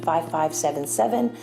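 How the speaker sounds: normal talking voice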